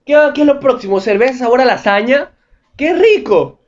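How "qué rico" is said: mock delight. Mock delight at turkey beer.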